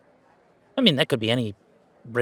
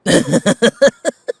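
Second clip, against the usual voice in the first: loud laugh; 10 semitones higher, 11 dB louder than usual. Acted. Burst of laughter in scene.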